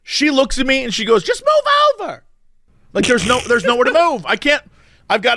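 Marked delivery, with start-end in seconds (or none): 1.2-2.2 much higher mocking woman's voice; mock snooty woman. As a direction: mimic her dismissive tone, up high. Retold woman dismissing him.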